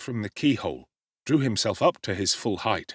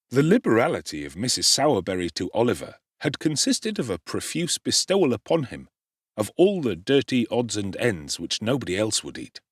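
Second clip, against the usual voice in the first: ordinary delivery, nothing marked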